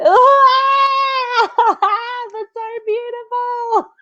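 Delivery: high-pitched wailing imitation of happy tears; mock weeping. Friend overcome at a beach wedding, mock wailing. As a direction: blubber it out in an exaggerated sob, very high. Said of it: "And I was weeping".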